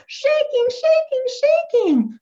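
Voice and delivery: high, loud playful chant; squealed, punchy repetitions; really excited. Shown as a demonstration. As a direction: urge a child to shake along. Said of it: "I'm gonna be making myself really excited".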